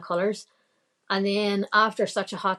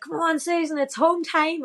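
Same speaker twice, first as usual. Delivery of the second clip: high, excited cheer from the roadside; cheering lads; 10 semitones higher, 3 dB louder than usual. Retold or imitated. Lads from the Lisburn club cheering Susan on, high excited call. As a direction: call her home like a fan at the finish.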